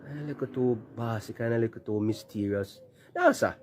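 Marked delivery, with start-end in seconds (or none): 3.2-3.6 much higher, punchy "No, sir"; emphatic refusal. Real, not acted. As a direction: shoot the refusal up high in disbelief. Emphatic rejection of candidate.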